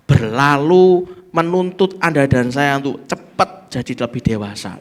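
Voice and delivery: ordinary talk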